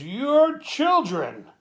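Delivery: high, slow, loud and pointed; cutting remark. Retold or imitated. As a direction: drag out each word like an accusation. Wife's cutting parting remark.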